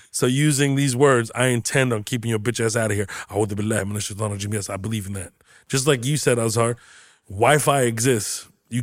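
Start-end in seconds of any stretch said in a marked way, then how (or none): 3.3-4.5 quick Arabic prayer; a hurried recitation; fast recitation. Shown as a demonstration. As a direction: rattle it off. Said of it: "recites it".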